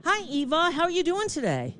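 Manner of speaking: sing-song politeness for the robot agent; high customer-service greeting; bright phone greeting. Acted.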